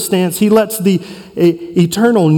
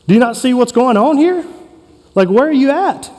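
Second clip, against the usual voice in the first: higher, whiny grumbling at God; mock complaint; 5 semitones higher than usual. Preacher mimicking how people complain to God, mock whiny. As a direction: play the impatient complainer. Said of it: "That's how I complain".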